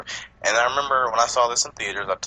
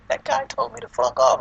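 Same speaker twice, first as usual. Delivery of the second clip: slightly higher imitated complaint; tattling complainer; 4 semitones higher than usual. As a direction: mimic someone tattling. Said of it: "they were just like".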